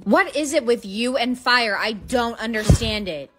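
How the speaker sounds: ordinary talk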